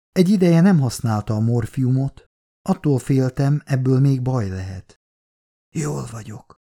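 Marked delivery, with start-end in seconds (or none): 5.7-6.5 softer, hoarse and barely voiced; a dying woman's hoarse whisper. A narrator's reading. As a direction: rasp it out in a weak whisper. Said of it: "suttogta rekedten".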